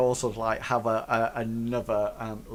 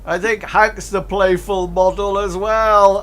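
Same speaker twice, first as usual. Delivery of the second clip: high, loud ruffian voice; loud jibe played to the gang; joking ruffian; 8 semitones higher, 10 dB louder than usual. Ruffian joking about Hank to his mates, high and loud.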